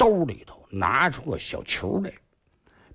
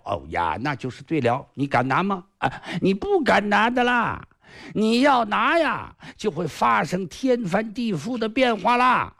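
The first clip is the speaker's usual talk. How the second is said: a lightly raised, smug villain's voice; boastful sly sorcerer; 6 semitones higher than usual. Acted. Sorcerer Kong Xiu boasting to the hero, smug and a bit higher. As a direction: crow over the island like a cocky magician.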